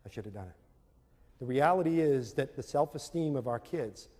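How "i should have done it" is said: quick, soft mumbled regret; lightly voiced Larry sighing over a missed answer; Larry's regret. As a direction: mutter it fast to yourself like a sore loser. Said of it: "And Larry goes".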